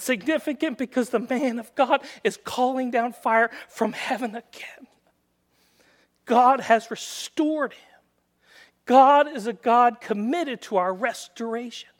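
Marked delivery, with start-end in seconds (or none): none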